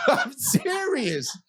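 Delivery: high, drawn-out words through real laughter; laughing protest. Real, not acted. Comedian cracking up at his own story, laughing protest. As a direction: insist through helpless laughter.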